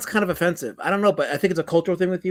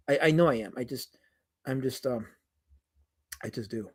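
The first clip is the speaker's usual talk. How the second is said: fading to a faint, lower mumble; deflating mumble; 7 semitones lower, 11 dB quieter than usual. Real, not acted. Streamer deflates after boasting he is a winner, quiet mumble. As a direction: lose steam after the boast.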